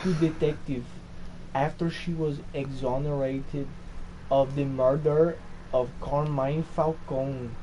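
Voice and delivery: put-on Portuguese accent. Friend reading a comic profile on request, Portuguese accent. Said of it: "Portuguese accent".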